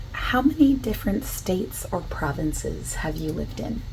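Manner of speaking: usual speaking voice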